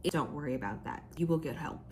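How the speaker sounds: ordinary delivery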